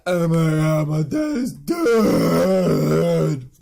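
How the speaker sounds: loud, drawn-out mock wail; hammed-up grief, stretching out the last word; mock anguish. [name] reacts to father's death.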